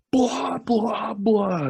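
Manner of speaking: high, rough blah-blah-blah; mock jeering noises. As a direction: imitate someone taunting with nonsense. Imitates character jeering at girl.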